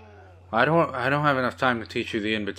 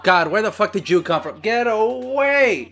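panicked yell; 9 semitones higher, 4 dB louder than usual. Real, not acted. Panicked at sudden monster.

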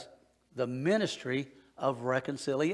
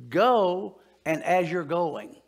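slow, loud, stretched-out "Go and as you're going"; emphatic; 4 semitones higher, 6 dB louder, much slower than usual. Shown as a demonstration. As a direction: lean hard on "Go and as you're going", drawn out. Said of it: "it's in a tense that says".

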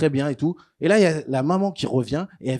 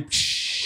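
long drawn-out hissing sizzle; sustained sizzle sound effect; sizzling noise; 8 dB quieter than usual. Retold or imitated. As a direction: imitate skin frying with a long "pshhh". Imitates skin sizzling in sun.

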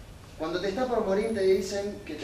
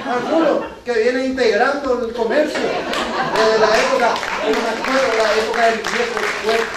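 mock indignation; 3 semitones higher, 5 dB louder than usual. Indignant about not being invited.

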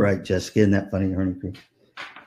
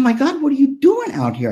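amazed; 14 semitones higher, 3 dB louder than usual. Retold or imitated. Surprised meeting the astronaut.

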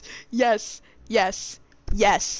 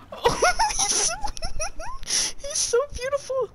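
high, giggling fangirl squeal over him; giggly swooning. Real, not acted. Gamer swooning over Leon's face, giggling high. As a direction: melt into laughter as you gush.

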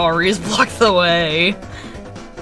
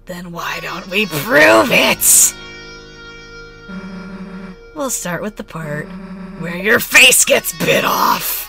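menacing threat; slower than usual. Pretend. Susie threatening Lancer, voiced.

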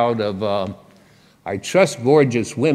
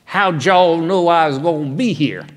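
put-on higher, louder joking Southern US accent; 6 semitones higher, 5 dB louder than usual. Greeting the leaflet protesters in a mock Southern US accent. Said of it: "in my best Southern accent".

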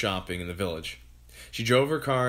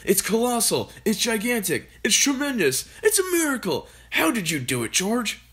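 high, louder rising excitement; ecstatic father; 11 semitones higher, 3 dB louder than usual. A narrator's reading. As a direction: build the thrill as the father. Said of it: "dancing round and round".

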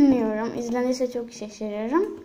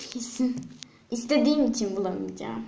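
ordinary delivery

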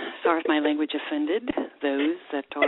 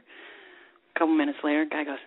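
normal talking voice

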